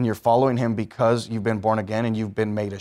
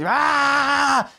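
mock infant cry, pitched way up; screaming baby; 14 semitones higher, much slower than usual. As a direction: wail it like a hungry newborn. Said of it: "they're just".

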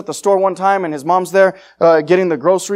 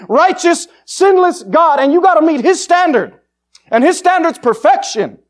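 raised, higher hellfire sermon at full force; fiery preaching; 6 semitones higher, 5 dB louder than usual. Acted. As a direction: preach it out loud to the crowd. Street preacher warns of hell.

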